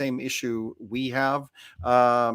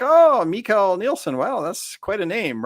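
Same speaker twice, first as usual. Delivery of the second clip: slow, higher and louder mock-impressed coworkers; amused Danish coworkers; 8 semitones higher, 5 dB louder, slower than usual. Danish coworkers teasing his son about his name, higher, slow and mock-impressed. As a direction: stretch it out like teasing colleagues. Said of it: "and they're like".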